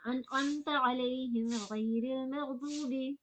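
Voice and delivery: slow recitation resuming from अंत अलैहिम. Shown as a demonstration. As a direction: recite it again slowly, unbroken. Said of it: "फिर से repeat करना है".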